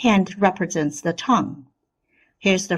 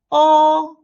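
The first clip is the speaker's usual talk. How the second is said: "Oh" high, loud, held level; first tone; 8 semitones higher, 5 dB louder than usual. Shown as a demonstration. Teacher leads repeat drill, high level oh. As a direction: hold "Oh" on one high note. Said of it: "The first tone is the highest".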